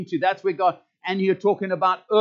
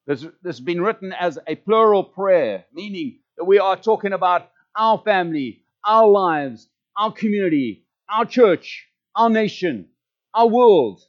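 building louder through the list; preaching; 4 dB louder than usual. Acted. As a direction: preach it with rising force.

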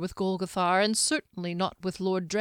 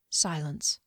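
ordinary delivery

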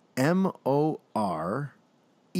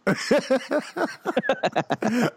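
high burst of laughter; 10 semitones higher than usual. Real, not acted. Host laughs at the voice.

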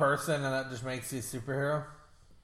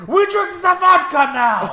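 slow, much higher and louder toast; boisterous Russian host; 13 semitones higher, 11 dB louder than usual. Retold or imitated. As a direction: announce the drinking like a party host. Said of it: "It's like".

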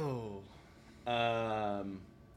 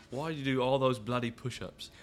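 put-on Cockney accent; 5 semitones higher, 7 dB louder than usual. Joins in Caine voice.

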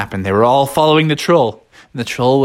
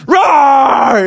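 loud, high mock roar; big hammed-up roar; bear roar; 13 semitones higher, 7 dB louder than usual. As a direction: roar like a grizzly. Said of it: "they were not scared".

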